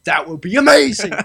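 laughing through the words; giggling, delighted. Real, not acted. Laughing at imagined Adam Sandler casting.

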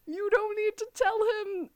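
high, loud, exasperated plea to the screen; urging her not to say it, voice climbing; exasperated yelling at TV. Pretend. Fan pleading loudly with Elena not to speak.

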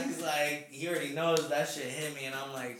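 ordinary talk, nothing marked

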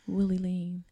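low seductive murmur; breathy come-hither tone; sexy ASMR voice. Pretend. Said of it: "And my sexy A S M R voice".

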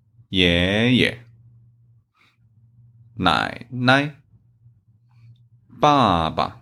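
"爷爷", "奶奶", "爸爸", each second syllable light and short, said slowly; slow model words for learners; neutral tone demo. Shown as a demonstration. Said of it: "Same as".

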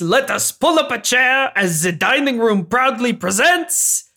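grand maître d' intro in a hammed-up French accent; bad French accent. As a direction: present it like a hokey French host. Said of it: "The bad French accent really got away".

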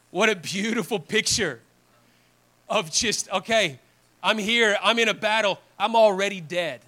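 forceful sermon climax, ringing out; impassioned preaching. Real, not acted. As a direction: drive the point home loudly to the congregation. Preacher builds to full force.

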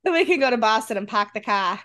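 put-on Boston accent, dropping the R's; mock Boston accent. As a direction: play a Bostonian with "pahk the cah". Mock Boston accent, joining accent game.

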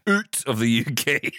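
slow Scottish "out" said as "oot"; exaggerated Scottish accent. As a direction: ham up the Scottish vowels, slowly. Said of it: "that's what she's trying to do".

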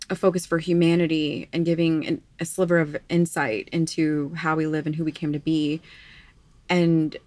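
usual speaking voice

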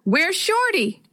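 high-pitched storybook call. A narrator's reading. Boy calling from down the street for his dog, calling out. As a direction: call it as if from a distance, little added volume. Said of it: "Tevin called from down the street".

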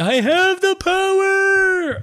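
very high, drawn-out heroic cry; hammed-up cartoon hero transformation call; He-Man. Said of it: "I was like He-Man".

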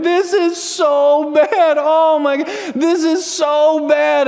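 put-on high, slow, drawn-out child's whine; whiny little girl. Pastor imitating his daughter whining from the other room, high and slow. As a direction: whine it out like a four-year-old over nothing. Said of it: "She just keeps saying that over and over".

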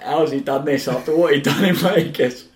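high, loud, laughing in disbelief. Retold or imitated. Teasing a teammate about Vegas, laughing disbelief. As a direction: squeal it through laughter.

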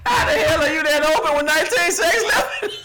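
disbelieving laughing yell; laughing shout. Real, not acted. Mocking the Raiders' collapse.

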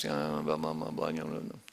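low droning blah blah of a complainer; mock grumbling. Pastor mocking grumblers, low droning blah blah. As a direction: drone it out like a dull moan.

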